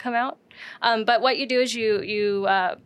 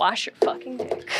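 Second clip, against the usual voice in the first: laughing through the words; 3 semitones higher than usual. Real, not acted. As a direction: giggle while saying it. Laughing repeat of the joke.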